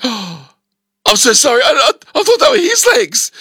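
high, rushed, mortified apology; squeaky embarrassed sorry; flustered apology. Retold or imitated. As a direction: blurt out the sorry in a panic. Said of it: "I'm like".

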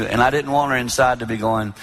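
ordinary talk

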